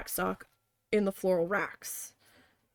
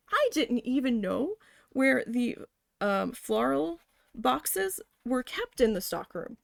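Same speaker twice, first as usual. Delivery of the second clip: ordinary talk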